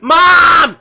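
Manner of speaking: loud, high call for mom; yelling for mom. Pretend. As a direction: holler across the house for your mom. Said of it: "be all like, um".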